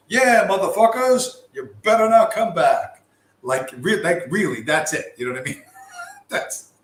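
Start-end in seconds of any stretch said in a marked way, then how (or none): 0.1-3.0 loud, higher mock taunt at retreating enemies; gloating Pope taunt. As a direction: crow at them like a cocky winner. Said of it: "the Pope comes out and goes".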